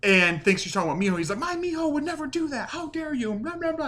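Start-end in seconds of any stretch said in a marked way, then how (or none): 1.4-3.9 very high-pitched indignant imitation; hammed-up offended protest; mock outrage. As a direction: play up offended fury in a high voice. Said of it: "and gets all pissed".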